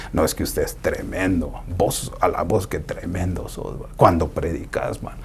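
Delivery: soft, low flattering voice; butter him up quietly; mock flattery. Flattering someone proud.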